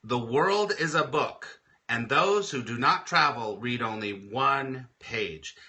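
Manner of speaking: slow, deliberate reading with each word weighed; slow weighty quote. Shown as a demonstration. Host repeats the quote slowly for viewers, weighty. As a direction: let the quote sink in slowly. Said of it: "That is huge".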